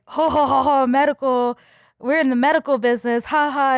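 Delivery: imitating loud, evasive belly laughs; mock hearty laughter. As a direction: boom out a fake ho-ho-ho. Retold men laughing off her question.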